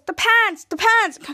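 high, loud repeated cry; excited alert. Retold or imitated. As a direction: shout it to alert the team, playacting. Retold character alerts the others.

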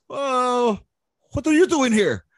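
put-on high, surprised Vietnamese-accented question. Sergeant major surprised to see Lopez back, Vietnamese accent.

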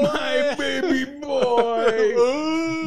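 high mock wail, very slow; grieving father. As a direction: stretch it out in hammed-up anguish. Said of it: "where he's like".

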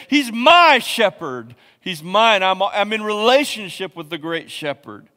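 high, loud proclamation; preacher demonstrating a passionate declaration; chest-beating passion. Shown as a demonstration. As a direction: shout it with vigor, beating your chest. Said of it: "beat your chest and say".

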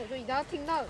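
ordinary talk

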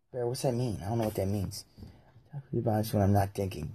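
usual speaking voice

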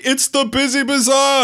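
high-pitched, thrilled shout; gleeful child quoting a cartoon; excited kid voice.

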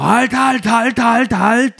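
high, loud rapid-fire shout of halt; shouting customs officer. Retold or imitated. Customs officer stopping a car that drives on, shouting. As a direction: yell it out urgently to stop a driver. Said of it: "auf einmal schreit er an".

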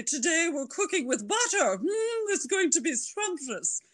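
put-on Julia Child. Imitating Julia Child for the host, very high and fluty. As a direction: swoop way up like the famous TV cook savouring butter. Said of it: "And she talked like this".

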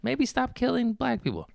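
very high, meek little voice; squeaky timid plea. Pretend. Comic squeaky voice mocking a meek plea to cops. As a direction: shrink into a tiny pleading squeak.

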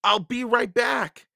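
high, loud hurried reply; calling out. A narrator's reading. Jack calls to Annie as he turns back, urgent. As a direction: call it back across a distance. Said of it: "Jack called".

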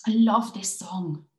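loud, drawn-out burst of affection; delighted exclamation. Acted. Voiced couple's everyday delight.

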